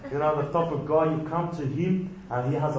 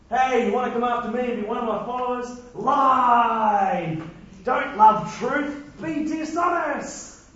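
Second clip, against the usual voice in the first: higher, mock shouted sermon urging lies; preacher mocking a wrong reading of Jesus, higher and shouted; mock preaching; 6 semitones higher, 3 dB louder than usual. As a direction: ham up a fake prophet telling people to lie.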